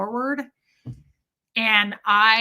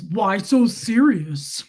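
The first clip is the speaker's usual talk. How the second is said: slow, louder mock taunt; 6 dB louder, much slower than usual. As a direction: draw it out, taunting like a jerk. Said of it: "And he goes".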